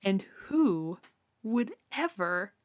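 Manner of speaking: drawn-out, baffled disbelief, rising high; mock outrage at a weird detail; incredulous self-quote. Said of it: "And I'm like".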